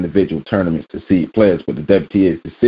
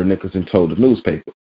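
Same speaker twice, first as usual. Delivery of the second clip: normal talking voice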